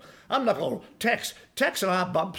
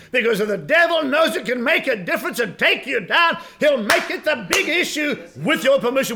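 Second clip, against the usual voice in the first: raised, driving preacher's voice; forceful preaching; 5 semitones higher, 5 dB louder than usual. Acted. Preacher warns congregation about the devil, forceful preaching. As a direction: preach it hard, pushing the key words.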